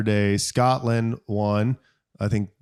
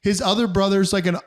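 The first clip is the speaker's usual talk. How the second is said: usual speaking voice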